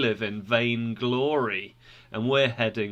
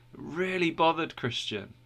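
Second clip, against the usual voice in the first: ordinary delivery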